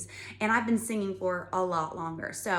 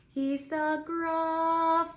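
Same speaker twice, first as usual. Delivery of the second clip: Gruffalo drawn out very long and higher; slow sung Gruffalo, higher; really long Gruffalo; 9 semitones higher, slower than usual. Shown as a demonstration. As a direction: stretch the word Gruffalo slowly. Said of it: "really, really long".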